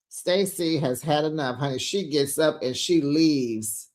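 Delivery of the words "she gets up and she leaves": usual speaking voice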